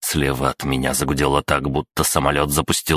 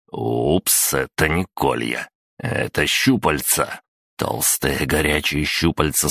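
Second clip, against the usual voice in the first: ordinary delivery, nothing marked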